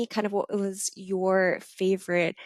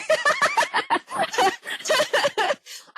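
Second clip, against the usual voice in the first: high squealing laugh. Real, not acted. Mishearing [name] as dinosaur.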